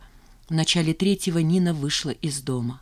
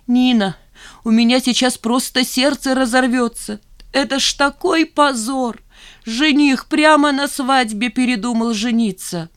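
put-on higher, louder tearful old mother's voice; 7 semitones higher, 4 dB louder than usual. Weeping mother telling Nina of the shame, tearful wail. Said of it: "вся заплаканная".

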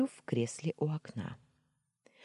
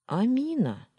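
reluctant; 9 semitones higher, 4 dB louder than usual. A narrator's reading. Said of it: "нехотя поделился Имран".

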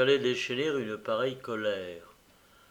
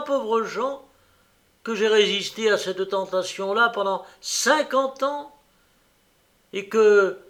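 higher, louder bitter rebuke; hateful anger; 7 semitones higher, 6 dB louder than usual. Acted. Old host lashes out at Jean, louder and hateful. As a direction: spit the reproach at the young man. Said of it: "une expression de haine indicible".

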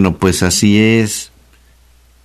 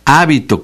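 hábito stressed on HÁ; stress on first syllable; 5 semitones higher than usual. Shown as a demonstration. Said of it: "con acento en la A". (hábito, acentuado en la primera sílaba)

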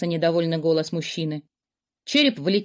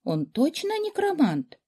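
scared princess; 10 semitones higher, slower than usual. A narrator's reading. Frightened princess asking.

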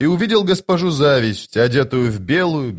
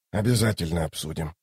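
low, soft grumbled promise; grudging grumble; 6 semitones lower, 8 dB quieter than usual. A narrator's reading. Toc going into the room, quietly grumbling. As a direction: grumble it under your breath. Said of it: "проворчал он".

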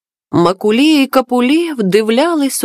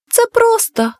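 high, melodic little shell voice; thin melodic voice; 10 semitones higher than usual. A narrator's reading. Magic shell reassures the friends, thin melodic voice. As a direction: answer in a thin, tuneful little voice. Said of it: "мовила мушля своїм тоненьким мелодійним голоском".

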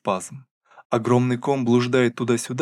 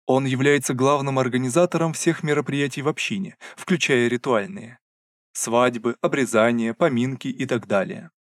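ordinary delivery

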